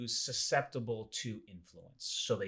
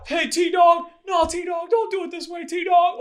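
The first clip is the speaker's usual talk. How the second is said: much higher, much louder desperate shout; pleading shout; 15 dB louder, slower than usual. Retold or imitated. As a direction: yell at the kidnapper, begging him to stop.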